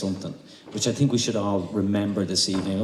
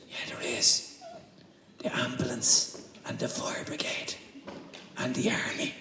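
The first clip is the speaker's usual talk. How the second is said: soft unvoiced whisper listing them; child's whisper; 3 semitones higher, 8 dB quieter than usual. Child whispers who else is in the house. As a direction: breathe the list secretly.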